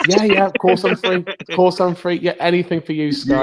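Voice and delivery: high, eager mock yes to the boss; eager presenter voice. Presenter answering Sky's call, high eager mock voice. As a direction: gush like you can't say no.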